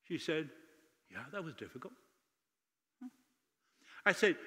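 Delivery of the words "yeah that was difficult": very soft quoted reply; hushed admission. Retold or imitated. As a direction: quietly admit the hardship as the old lady. Retold admission of hardship.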